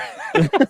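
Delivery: squeaky high-pitched laugh. Real, not acted. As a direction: burst out in a high giggle. Laughing at own impression.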